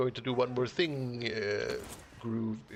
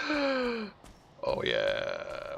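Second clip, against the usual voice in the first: delighted surprise; 10 semitones higher than usual. Real, not acted. Notices flickering light effect.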